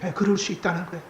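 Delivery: usual speaking voice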